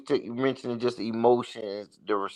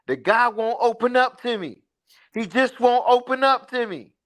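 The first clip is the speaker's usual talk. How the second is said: higher-pitched imitation of a woman's complaint; mock complaint, pitched up; quoted complaint; 10 semitones higher, 3 dB louder than usual. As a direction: relay her grievance in a raised pitch.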